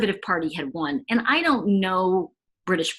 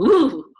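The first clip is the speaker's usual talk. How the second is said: shudder; 3 semitones higher, 7 dB louder than usual. Retold or imitated. Reaction to Bundy's evil.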